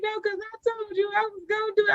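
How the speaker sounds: high mock-weepy client; play-acted high, teary insistence; retold tearful protest. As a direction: imitate a client protesting through boo-hoo tears. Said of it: "boo-hoo and tears".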